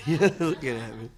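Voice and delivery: real laugh through the words. Real, not acted. Answering the interviewer about revitalizing Mobilian, laughing. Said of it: "Oh, no".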